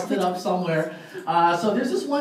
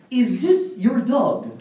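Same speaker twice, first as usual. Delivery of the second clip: slow, higher-pitched comic inspector voice; Inspector Clouseau; 4 semitones higher, much slower than usual. Acted. Inspector asks the hotel clerk about the dog, slow comic impression. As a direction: draw out each word as a bumbling inspector. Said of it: "Inspector Clouseau".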